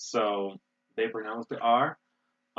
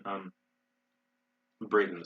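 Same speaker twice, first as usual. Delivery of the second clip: ordinary talk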